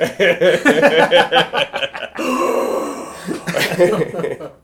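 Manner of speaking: long burst of loud laughter. Real, not acted. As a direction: crack up hard. Long loud laugh at the duck joke.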